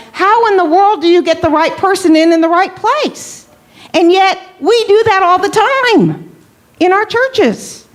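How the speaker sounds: high-pitched incredulous exasperation; exasperated. Real, not acted. Trainer despairing at how churches fill roles, exasperated. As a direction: pitch it up, as if it makes no sense. Rhetorical rant about churches' poor selection.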